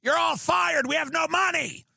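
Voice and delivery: high, loud panicked CEO shout; panicked CEO yell. Retold or imitated. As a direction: yell it like a boss whose company just collapsed. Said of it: "it was like".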